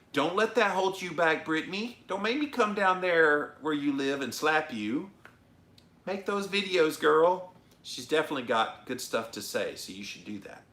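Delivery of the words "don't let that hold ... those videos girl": high-pitched playful threat; sassy mock-stern pep talk, raised and high; mock scolding. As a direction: scold a friend in jest, voice pitched up. Mock scolding a viewer into action.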